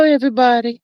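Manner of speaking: ordinary talk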